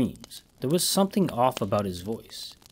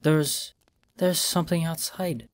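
frightened whisper; 5 semitones higher than usual. A narrator's reading. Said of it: "she whispered over the phone".

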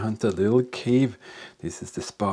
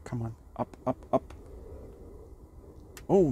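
usual speaking voice

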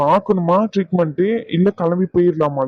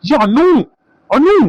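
raised, higher call to get her attention; 8 semitones higher, 5 dB louder, much slower than usual. Acted. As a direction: call out the name sharply. Calls out to Anu. (சத்தமா கூப்பிடுதல்)

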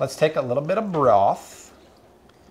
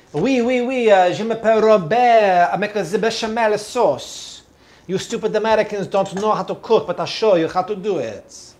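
hammed-up French chef accent, higher; 5 semitones higher, 3 dB louder than usual. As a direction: play a pompous French chef, French accent and all. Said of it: "Bobby, you have a great French accent".

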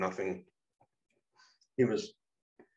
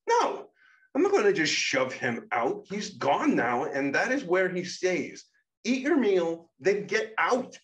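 higher, louder voice for the character Shadow; Shadow's voice; 10 semitones higher, 6 dB louder than usual. Acted. Shadow, irritated at the girl for asking, higher and louder. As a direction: pitch up and push the volume, playing Shadow. Shadow refuses to bring him back.

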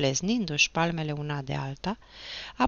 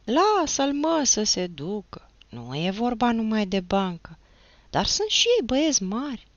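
higher, put-on honest, understanding voice; imitating stepmother; 6 semitones higher, 5 dB louder than usual. A narrator's reading. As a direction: mimic stepmother's earnest pleading. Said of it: "căutând să-și potrivească glasul și să-l facă asemănător cu al mamei vitrece". (imitând mama vitregă, cinstit și înțelegător)

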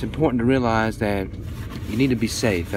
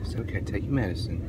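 gentle hushed comfort; 3 semitones lower, 9 dB quieter than usual. Real, not acted. Mother quietly comforting child.

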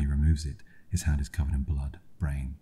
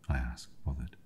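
ordinary delivery, nothing marked

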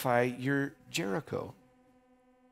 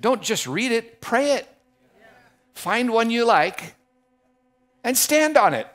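higher and louder, punching it out; preaching; 10 semitones higher, 8 dB louder than usual. Acted. Preacher urging the congregation to pray, forceful and loud. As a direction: exhort the congregation at full force.

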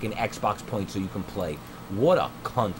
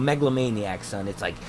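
ordinary talk

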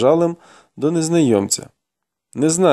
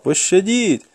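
restrained desperate yelp; desperate plea; 6 semitones higher, slower than usual. A narrator's reading. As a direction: beg desperately in a raised pitch. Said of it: "відчайдушно звиваючись, заволав".

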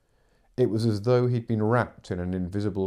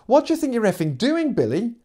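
much higher, louder furious yell; angry snap; 6 dB louder than usual. Acted. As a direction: snap at him over the radio. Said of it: "snapped her voice".

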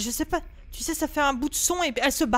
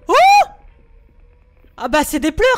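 loud high panicked cry; 10 semitones higher, 13 dB louder than usual. Real, not acted. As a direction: panic at the ghost's crying. Ghost crying on radio, panics.

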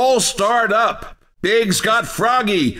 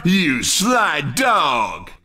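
teasing admiration; 9 semitones lower, much slower than usual. Acted. Announcer teases player five.

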